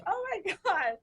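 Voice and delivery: high squealed disbelief; friend's shock. Retold or imitated. Friend recognizing her caller, high squealed shock. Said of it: "And she's like".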